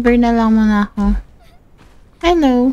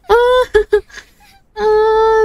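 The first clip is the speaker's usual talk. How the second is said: high, sudden scream; frightened shriek; 7 semitones higher than usual. Real, not acted. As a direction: shriek at the jump scare. Jump scare. (biglang tili ng takot)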